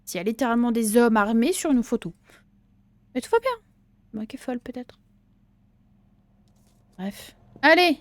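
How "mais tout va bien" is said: sarcastic. Real, not acted. Sarcastic high reassurance.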